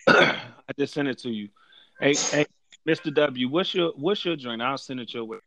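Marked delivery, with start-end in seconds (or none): none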